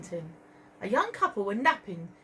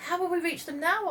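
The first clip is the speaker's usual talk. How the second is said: worried Marie; 9 semitones higher than usual. Acted. Marie asks.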